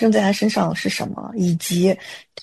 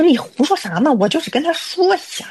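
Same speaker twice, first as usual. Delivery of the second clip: 嗔怪地否认; 8 semitones higher than usual. Retold or imitated. 姐妹否认吓人说法.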